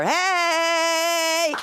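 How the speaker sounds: long, high held whoop; mock cheer. Comic cheering herself to the audience as survivor, mock cheer. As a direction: sing out a drawn-out celebratory holler.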